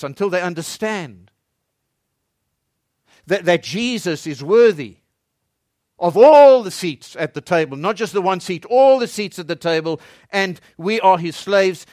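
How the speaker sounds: loud, rising preacher's cry, hammering repeats; fervent pulpit build; preaching at full force. Acted. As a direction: build the crowd up, lean into each until.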